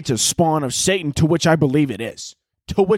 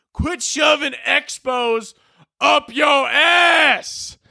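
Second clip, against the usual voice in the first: high, drawn-out Southern holler; attempted Southern accent yell; 12 semitones higher, much slower than usual. Dad yelling at Connor, hammed-up Southern accent. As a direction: stretch every word in a yelled Southern drawl. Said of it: "trying to do a southern accent".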